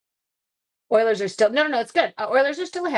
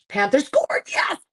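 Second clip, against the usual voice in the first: excited cheer; 6 semitones higher, 5 dB louder than usual. Real, not acted. Live hockey score during stream.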